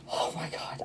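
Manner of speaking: breath-only, overwhelmed whisper; stunned whisper. Real, not acted. Reader cannot believe the cards, stunned whisper. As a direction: whisper it in disbelief.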